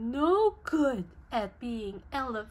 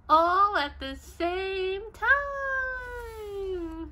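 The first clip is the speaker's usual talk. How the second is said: drawn out; 8 semitones higher, much slower than usual. Acted. Hog as Piggy, drawn out.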